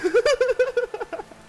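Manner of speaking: a giggle that shoots up high; high-pitched laugh. Real, not acted. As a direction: squeal of laughter. Laughing after shout-out.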